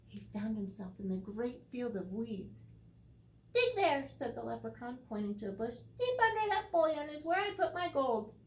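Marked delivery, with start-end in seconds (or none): 3.5-4.1 high, louder command; leprechaun voice. Acted. The leprechaun orders Tom to dig, high and loud. 6.0-8.3 high, louder command; leprechaun voice. Acted. The leprechaun orders Tom to dig, high and loud.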